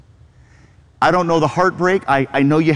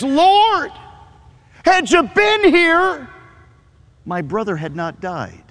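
Martha pleading; 6 semitones higher, slower than usual. Retold or imitated. Pastor voices Martha reproaching Jesus, high pleading grief. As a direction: voice Martha's grieving accusation. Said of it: "here's what she says".

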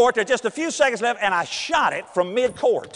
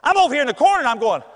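ordinary talk, nothing marked

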